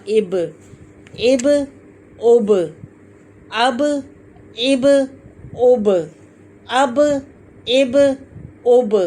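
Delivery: "अब, इब, उब" each with the b echoing as "buh"; qalqalah bounce. Shown as a demonstration. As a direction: drill "अब, इब, उब", bouncing every final b. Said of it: "लौट के आवाज़ वो ब वापस आती है".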